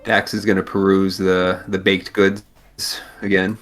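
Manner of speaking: ordinary delivery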